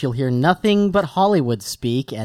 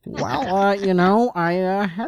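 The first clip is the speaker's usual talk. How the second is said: halting filmmaker impression; hesitant, uh-laden interviewee; George Lucas; 4 semitones higher than usual. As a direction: stammer through an answer as a famous director. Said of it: "So George, tell us".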